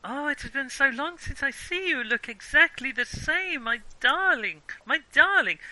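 put-on high, gushing German-accented reunion; loud, effusive German-accented endearments. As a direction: greet a long-lost friend in a German accent. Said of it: "doing a German accent there".

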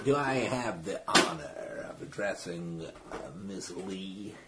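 lightly voiced formal gentleman, slow and soft; drawn-out, courteous inquiry; hushed, slightly formal introduction; polite visitor. Game master plays polite visitor.

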